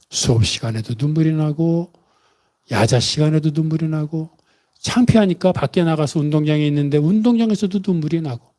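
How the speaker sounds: ordinary delivery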